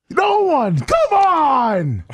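high, exasperated cry; exasperated plea. Real, not acted. Asking chat to help him say it, exasperated plea. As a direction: call out for help in high frustration.

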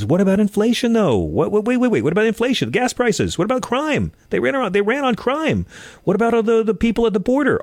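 high-pitched, hammed-up what-about whining; mock Republican outrage. Host parodying Republicans asking about inflation, high mock whine. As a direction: parody a frantic pundit, voice pushed way up. Mocking Republican talking points.

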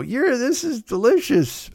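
high, slow forced compliment; fake praise. Chef forcing praise of garbage food, high and slow. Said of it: "the chefs have to eat it and go".

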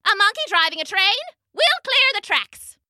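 high, quick startled caller; another phone voice. A narrator's reading. Another voice hearing of monkey driver, high hurried voice. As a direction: pitch it way up and hurry. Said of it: "said another voice on the phone".

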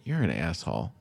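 low, soft aside meant for one listener; imitated quiet insult. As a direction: drop it under your voice, imitating the heckler. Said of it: "just so I could hear it".

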